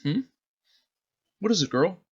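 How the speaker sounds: put-on a kid puzzled by his dog; lightly voiced boy, curious; curious little boy.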